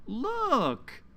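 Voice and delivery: a high, bright call of look; excited look. Acted. Storyteller showing children a new bird, excitedly high. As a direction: point it out with a sudden burst of delight.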